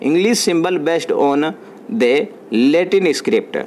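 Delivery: very slow, word by word English; dictation pace. Shown as a demonstration. Teacher dictating a definition to students, very slow. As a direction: read it out slowly so they can write. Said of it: "dictating a definition".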